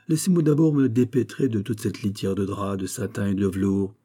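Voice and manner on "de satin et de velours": ordinary delivery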